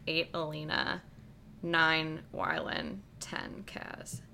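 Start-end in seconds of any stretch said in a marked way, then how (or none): 3.2-4.2 whispering. Real, not acted. Quiet end of the list.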